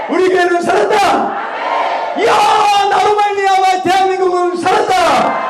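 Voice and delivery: high, driving revival declaration; full-force preaching. Real, not acted. Preacher proclaims healing and Spirit-given words to congregation, full force.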